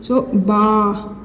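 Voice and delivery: बा held long and slow; long vowel drill on बा; drawn-out vowel. Shown as a demonstration. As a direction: stretch the आ of बा. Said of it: "इसे हमेशा खींच कर पढ़ेंगे".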